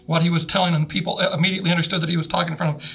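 ordinary talk, nothing marked

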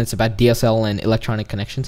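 ordinary talk

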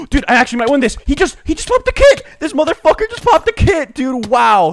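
very high, loud disbelieving outburst; stunned gamer's shout; shocked excitement. Real, not acted. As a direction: yell in high-pitched disbelief at the play. Shocked live reaction mid-fight.

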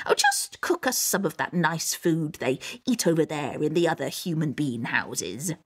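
put-on low troll voice; casual Mother Troll. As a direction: chat offhandedly as an old troll mother.

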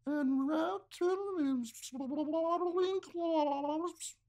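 high, very slow mermaid voice. Acted. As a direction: sing it sweetly like a mermaid. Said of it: "A mermaid voice".

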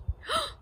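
sharp high gasp; lion gasp. Acted. Lion sees the birthday cake, sharp gasp. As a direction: gasp in surprise. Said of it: "The lion gasped".

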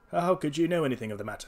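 nervous man taken aback, lightly acted; quavering voice. A narrator's reading. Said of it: "asked the man in a quavering voice".

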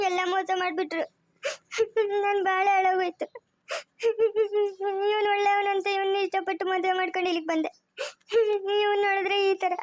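acted sobbing between phrases; crying. Deceived bride lamenting her ruined life, sobbing. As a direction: wail it out, breaking into sobs after each line. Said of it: "ಅಳ್ಬೇಡ ಸುಮ್ನೆ ಇರು, ಯಾಕೆ ಅಳ್ತಾ ಇದ್ಯಾ".

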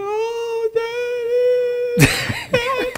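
very high wailing call; retold crying scream. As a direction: cry out to Daddy, re-enacted at full pitch. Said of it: "crying and screaming like that".

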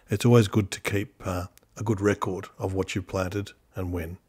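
low, soft and measured documentary read; quiet recorded how-to voiceover; voiceover narration. Acted. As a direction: calmly narrate the steps for the viewer.

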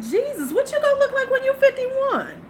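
high, shocked disbelief; incredulous. Retold or imitated. To young women with fillers, high shocked disbelief. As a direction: squeal it in disbelief. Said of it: "I'm like".

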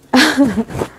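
flustered giggle; nervous laugh. Real, not acted. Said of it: "Saking senengnya jadi grogi". (tawa gugup karena senang)

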